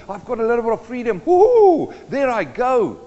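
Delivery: a fish shouting woohoo as it swims free, fast; gleeful fish. Pretend. Preacher voicing a hooked fish that whoops at its freedom, fast and gleeful. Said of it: "the fish swims and he goes".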